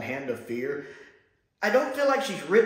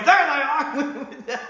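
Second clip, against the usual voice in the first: higher, louder triumphant shout; guards shouting; 10 semitones higher, 4 dB louder, faster than usual. Retold or imitated. Hunters spot their aliens, loud excited shout. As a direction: bellow it as they charge. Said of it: "You don't run to somebody going".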